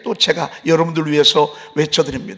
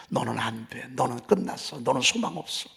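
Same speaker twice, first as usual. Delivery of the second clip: low, hushed voice of discouragement; imitated insidious murmur; 6 semitones lower, 8 dB quieter than usual. Voice of darkness talking the listener down, low imitated murmur. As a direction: murmur it low and soft, as if beside the ear. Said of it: "옆에서 자꾸 속살거리죠".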